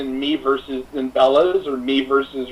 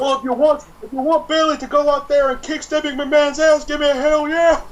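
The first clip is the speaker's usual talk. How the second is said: mock crowd hype; 10 semitones higher, 3 dB louder than usual. Hyping a crowd like a wrestler.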